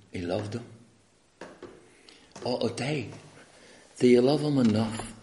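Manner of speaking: ordinary talk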